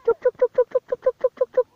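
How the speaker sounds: high, sing-song chicken call. Real, not acted. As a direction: call the chooks in, high and repeated. Calling the chickens again.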